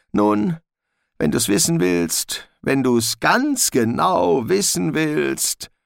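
high, nervous little dog; a jittery mongrel pleading; talking dog voice. Acted. Gespode the dog speaks.

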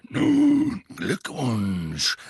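rasping gruff congratulation; grudging gruff veteran; gruff auror. Acted. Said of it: "sagte Moody".

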